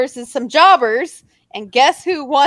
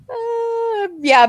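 usual speaking voice

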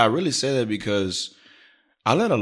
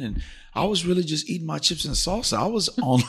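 laid-back comic self; 9 semitones higher, 4 dB louder than usual. Retold or imitated. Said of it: "I said to her, I was like".

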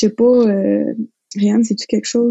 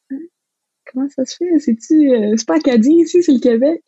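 retold surprise, pitched higher; a confused, higher-pitched what?; surprised; 6 semitones higher than usual. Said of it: "j'étais comme". (étonnement rejoué, voix plus aiguë)

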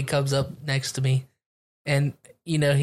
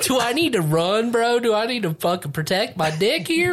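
mock panic; 7 semitones higher than usual. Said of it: "I'd be like".

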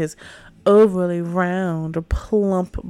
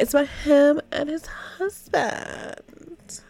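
mock dreamy; 7 semitones higher than usual. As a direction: gush sarcastically about his purity. Mocking the character's devotion.

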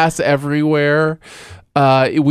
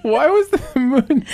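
laughing; 12 semitones higher than usual. Real, not acted. Laughing mid one-word story.

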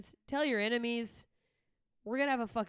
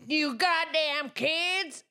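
put-on high, slow cranky old man; grumpy old man; 8 semitones higher, 7 dB louder, much slower than usual. Host joining the old man bit, high slow crank. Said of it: "horrifying old man".

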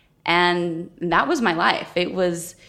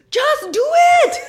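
put-on much higher and louder, a barked Russian-accented order; Russian coach accent; 7 dB louder than usual. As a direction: snap it out like a strict Russian coach. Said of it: "she'd have this thick Russian accent".

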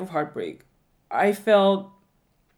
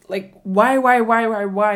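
frustrated why; 2 semitones higher, 7 dB louder than usual. Retold or imitated. Reliving frustration, repeated whys.

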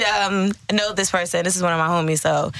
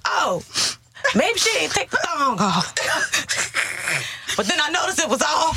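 shocked shouting; 7 semitones higher, slower than usual. Real, not acted. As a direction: yell it out in mock horror. Horrified retelling, shouting.

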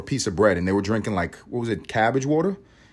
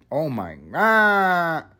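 exasperated; 5 semitones higher, much slower than usual. Real, not acted. Exasperated drawn-out sigh.

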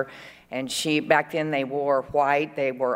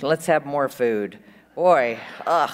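ordinary talk, nothing marked

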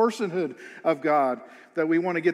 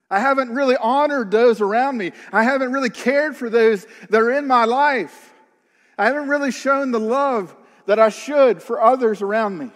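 higher and louder, pressing the confession home; fervent preaching; 4 semitones higher, 5 dB louder than usual. Real, not acted. A preacher confessing his failings to the congregation, fervently. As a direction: preach it with full force.

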